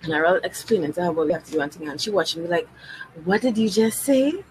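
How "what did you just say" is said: ordinary delivery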